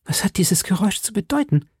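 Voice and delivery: high, breathless whisper of dread; a scared young woman whispering; frightened whisper. A narrator's reading. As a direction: whisper it as if the sound could find her. Frightened woman hears horses.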